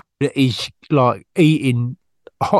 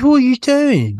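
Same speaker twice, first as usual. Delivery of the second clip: indignant outburst; 10 semitones higher than usual. Retold or imitated. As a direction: voice jumps much higher, incredulous. Indignant retelling, pitch jumps.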